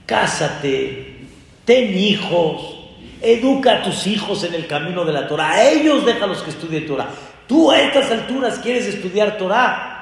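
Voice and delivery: a father brushing off a grown son's wish; father scolding. Retold or imitated. Father scolds his twenty-eight-year-old son, exasperated and fast. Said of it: "Le dijo su padre".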